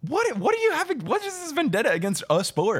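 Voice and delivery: very high, stammered outrage; incredulous protest. Real, not acted. Golf defender challenging a vendetta against a sport, high-pitched incredulity. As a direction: voice shooting up in baffled protest.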